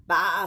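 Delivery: loud baa; sheep bleat. A narrator's reading. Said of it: "said the sheep".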